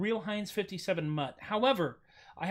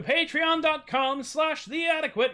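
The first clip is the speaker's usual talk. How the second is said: announcer plug; 9 semitones higher, 5 dB louder, slower than usual. Shown as a demonstration. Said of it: "I would direct you to".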